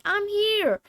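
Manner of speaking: character voice. Acted. Character voiced, far timbre.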